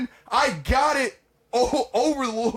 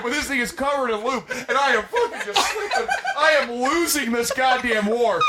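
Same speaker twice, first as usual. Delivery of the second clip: ordinary talk, nothing marked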